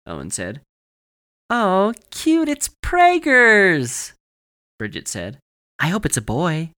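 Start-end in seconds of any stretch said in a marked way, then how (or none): 1.5-4.1 very high, loud, drawn-out cooing; shrieky, exaggerated delight; mock gushing squeal. Teasing mock gushing at the pumpkin. 5.8-6.7 high, loud teasing coo; excited, put-on sweetness; mock gushing. Teasing continues about a pregnant pumpkin.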